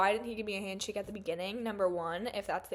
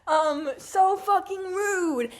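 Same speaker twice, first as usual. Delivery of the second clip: exasperated; 9 semitones higher, 7 dB louder, slower than usual. Real, not acted. Outraged at the rude reader.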